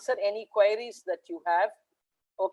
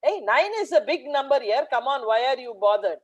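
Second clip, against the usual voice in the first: ordinary talk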